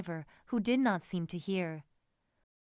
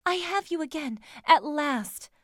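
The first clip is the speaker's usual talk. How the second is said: high, elated lover's exclamation; exclaiming; 7 semitones higher, 6 dB louder than usual. A narrator's reading. Emma embracing her lover at last, exclaiming. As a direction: pitch it way up, joyful at the reunion. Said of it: "she exclaimed".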